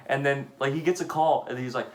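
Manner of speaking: ordinary delivery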